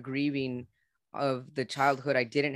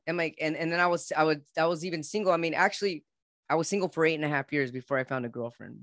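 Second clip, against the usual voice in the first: normal talking voice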